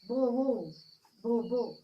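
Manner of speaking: loud "bo, bo" barks for the dog; loud dog barking. Retold or imitated. Teacher acting out Moti the dog's barks to the class, loud barking. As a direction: bark it out like the pet dog. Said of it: "What he barked?".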